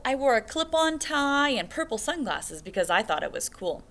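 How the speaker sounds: lively, expressive letter reading; reading a letter to children. Acted. Teacher reading Todd Parr's note to second graders, expressive. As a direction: read the author's note warmly to the class. Reads author's note aloud.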